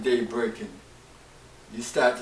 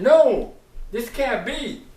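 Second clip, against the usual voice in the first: high, loud NO, then dropping back; loud protest; 9 semitones higher, 8 dB louder, slower than usual. Retold or imitated. Preacher retelling his outburst at the news, loud protest. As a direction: burst out with NO, then insist it can't be. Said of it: "I'm saying".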